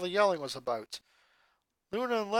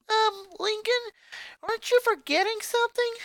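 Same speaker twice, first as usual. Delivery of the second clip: Lola's question. Acted. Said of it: "Lola s-, asked".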